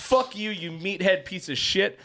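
high, slow retold cursing; angry insult. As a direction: re-enact spitting an insult, drawn out. Re-enacting insult to the Marine.

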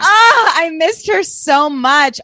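high, loud, slow gushing wail; overjoyed at a character's return; excited squeal. Real, not acted. Cohost gushing over the mannequin's return, thrilled. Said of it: "I was so excited".

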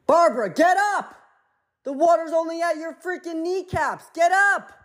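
high-pitched shouting at Barbara to stand up; exasperated yell. Retold or imitated. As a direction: yell up at her, fed up that she won't just stand. Yelling at Barbara in water.

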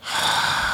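long, airy sigh; exasperated sigh. Real, not acted. Host despairs at friend's spoilers, exasperated sigh. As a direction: let out a weary breath.

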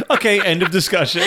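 laughing through the words. Real, not acted. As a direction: say it while laughing. Laughing while cutting off topic.

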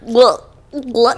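exaggerated imitated gulps; mock someone's noisy swallowing; cartoonish gulp noises; Goofy-like gulping. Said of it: "he sounds like Goofy".

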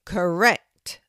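loud tree voice declaring "Correct"; booming oak tree. A narrator's reading. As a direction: announce it like a great old tree.

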